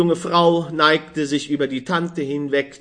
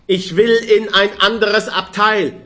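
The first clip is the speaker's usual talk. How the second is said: loud, higher cry to get away; shouting; 6 semitones higher, 5 dB louder than usual. Acted. Stout woman demanding another compartment, shouting. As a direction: demand it at the top of her voice. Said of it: "schrie die behäbige Frau".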